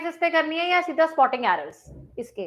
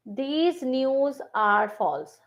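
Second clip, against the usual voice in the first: drawn out word by word, softer; very slow, softer dictation; 3 dB quieter, much slower than usual. Shown as a demonstration. Teacher repeating the sentence very slowly for students. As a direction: stretch every word for the class.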